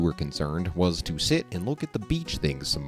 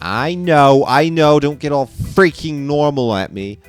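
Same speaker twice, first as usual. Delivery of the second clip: exasperated retort; 7 semitones higher, 7 dB louder than usual. Acted. Acted retort to a character.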